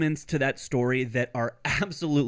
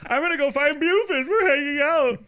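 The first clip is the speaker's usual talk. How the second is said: mock girly voice; 10 semitones higher, 5 dB louder than usual. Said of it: "I'm like".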